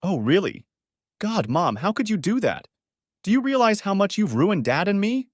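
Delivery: high-pitched, accusing daughter; outraged outburst. A narrator's reading. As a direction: let the anger burst out, pitched high. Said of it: "Allie couldn't hold back any longer".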